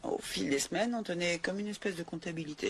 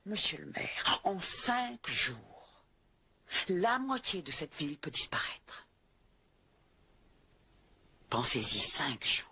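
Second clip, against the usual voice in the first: grim whisper; 3 semitones lower, slower than usual. Acted. Grave warning to the mayor.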